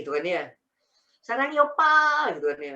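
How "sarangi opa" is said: high, slow mock-Korean cooing; drawn-out Korean drama voice; playful Korean imitation. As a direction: gush like a Korean drama fan. Mimics a Korean drama fan.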